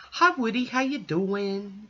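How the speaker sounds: high, sweet voice for a pet; dog greeting voice. Real, not acted. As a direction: coo at the dog. Greeting her dog Woody.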